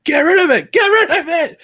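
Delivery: high, loud yell at the TV; re-enacted yelling. Sports fan reliving a play at the TV, yelling. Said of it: "I was yelling at my T V".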